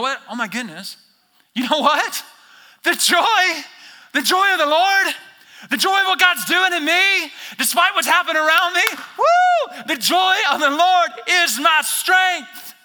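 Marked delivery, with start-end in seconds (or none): 1.5-12.7 high, loud joyful proclaiming; ecstatic shout. Acted. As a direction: burst out with joy. Revelation of joy acted out.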